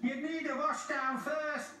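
high-pitched teasing engine; high, cheeky put-down; chuckling James. A narrator's reading. James teasing muddy Gordon, high and amused. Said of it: "chuckled James".

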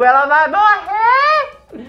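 high, loud, drawn-out cry; hammed-up horrified scream; panicked wail. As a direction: wail it out slowly in dramatic panic. Reliving his panic at the levitation.

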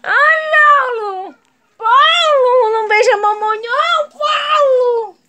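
high, loud, drawn-out wail; loud whiny squeal of complaint; protesting cry. Real, not acted. Dog kissing her, she cries out.

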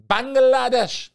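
Bangladesh in a high, loud, sing-song lilt falling at the end; savoring the name. Shown as a demonstration. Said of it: "It's so relaxed".

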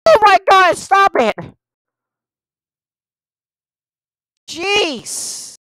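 high, loud panicked yelp; real fright scream; frightened shriek. Real, not acted. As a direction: shriek at the sudden scare. Jump scare again in game.

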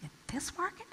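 hushed, skeptical whisper; best friend doubting the house sale, whispered; doubtful whisper. Retold or imitated. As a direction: whisper it like a warning. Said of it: "one of my best friends goes".

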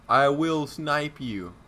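slow, drawn-out taunt; slow menacing threat. Real, not acted. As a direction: drag the threat out slowly. Threatening, slowed.

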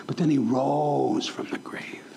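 slow breathy whisper of the rising; low whispered awe. Acted. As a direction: hush it like a secret, slowly. Hushed dramatic moment in sermon.

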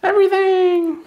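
high, loud shout; cheering shout. Real, not acted. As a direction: yell it like a crowd. Crowd answers loudly.

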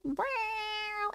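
soft high meow; high mock meow. Streamer voicing her cat's first meow, high soft mew. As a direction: meow softly like the cat. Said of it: "sometimes I meow like".

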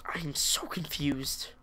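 slow whispered aside; lost and whispering in a cave. Real, not acted. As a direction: breathe it to yourself. Lost, muttering to himself.

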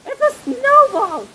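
high, thrilled announcement; excited. Real, not acted. Kid showing viewers a snowball, excited.

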